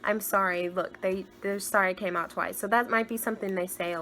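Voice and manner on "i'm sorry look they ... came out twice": normal talking voice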